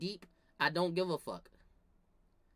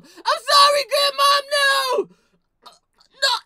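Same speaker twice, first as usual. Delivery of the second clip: shrill mock-crying cousin caught by grandma, much higher and louder; high crying shout; 17 dB louder than usual. Cousin caught dicking the soda, mock-crying shout. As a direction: wail it like a busted kid.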